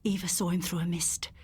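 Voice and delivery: ordinary delivery, nothing marked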